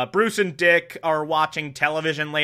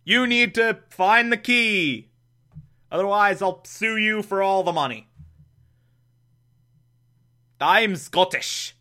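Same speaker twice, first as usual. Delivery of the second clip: raised-pitch mock Scottish accent; 5 semitones higher than usual. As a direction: play a cartoonish Scot making demands. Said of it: "this Scottish stereotype is like".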